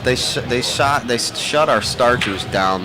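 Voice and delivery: ordinary talk, nothing marked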